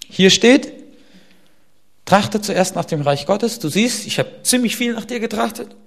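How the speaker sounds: high, loud pleading prayer voice; bold prayer. Retold or imitated. Corrie ten Boom reading God the Bible, high insistent prayer. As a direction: remind God of his promise, cheekily insistent.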